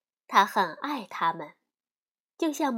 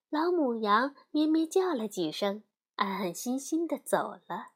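ordinary delivery

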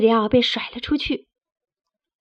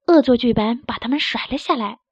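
plain reading voice

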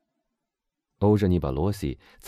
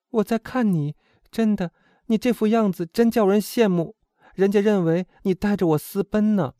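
put-on high, animated young woman's voice; girl companion gushing, pitched high; admiring, teasing friend; 13 semitones higher, 5 dB louder than usual. A narrator's reading.

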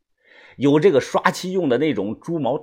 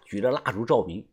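ordinary delivery

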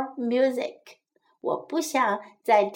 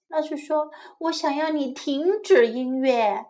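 usual speaking voice

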